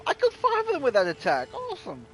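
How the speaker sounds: fast, high, loud burst of joy; excited. Real, not acted. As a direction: blurt out the victory. Kill five goblins at once.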